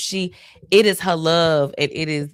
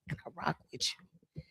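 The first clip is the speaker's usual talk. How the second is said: whisper; 2 semitones higher, 14 dB quieter than usual. Retold or imitated. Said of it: "I'm like".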